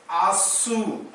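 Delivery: "asu" with firm stress on its end; stressed syllable. Shown as a demonstration. Teacher showing students where stress falls, stressed "asu". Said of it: "you put stress on asu".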